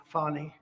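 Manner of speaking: "Fani" repeated as FAH-nee; her own pronunciation. Retold or imitated. Repeating the prosecutor's own way of saying it, "Fani" as FAH-nee. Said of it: "as she would say".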